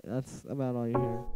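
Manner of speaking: ordinary talk, nothing marked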